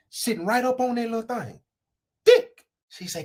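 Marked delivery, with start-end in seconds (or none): 2.2-2.7 high loud exclaim; high exclamation. Acted. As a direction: blurt it out high.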